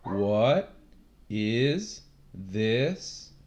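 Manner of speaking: What is this said with a rising tune; rising intonation example; rising tone demo. Shown as a demonstration. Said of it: "it's a rising tone".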